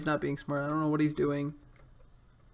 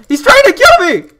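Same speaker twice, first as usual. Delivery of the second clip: shrieking, much higher and louder; panicked shout; 22 dB louder than usual. Real, not acted. Gamer screaming while an enemy chases him.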